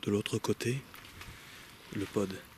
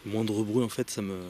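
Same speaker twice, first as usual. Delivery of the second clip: ordinary talk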